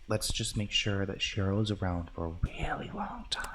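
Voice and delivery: soft whispered prayer; whispering. Real, not acted. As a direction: breathe the words in a whisper. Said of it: "Why are you whispering?".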